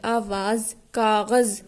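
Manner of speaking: "आवाज़" and "कागज़" each ending on a buzzing z; careful word-by-word model of "आवाज़, कागज़" with final z; teacher dwells on z in "आवाज़, कागज़"; z-sound demo. Shown as a demonstration. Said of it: "यहाँ पर जा की ही आवाज़ आ रही है".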